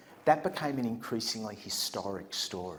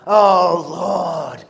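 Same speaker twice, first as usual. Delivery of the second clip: higher, loud, very slow prayer cry laughing; hammed-up prayer shout; 8 semitones higher, 14 dB louder, much slower than usual. Albie praying to the group, hammed-up loud prayer shouted through laughter. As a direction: bellow the plea, cracking up. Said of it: "he goes".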